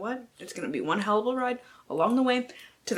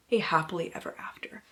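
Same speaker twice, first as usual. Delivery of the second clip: whisper; 5 semitones lower, 6 dB quieter, faster than usual. Acted. Dreamy reading of blurb.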